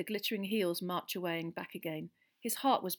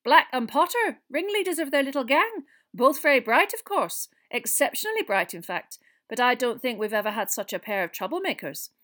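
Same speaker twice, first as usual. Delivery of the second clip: higher, loud schoolmistress voice; teacher passing judgement, pitched up; brisk woman professor; 6 semitones higher, 12 dB louder than usual. A narrator's reading. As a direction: recall star pupils with emphatic authority.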